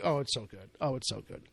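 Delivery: low, soft swooning rave; hushed rave. Retold or imitated. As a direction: hush it out like you're hooked. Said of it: "he was like".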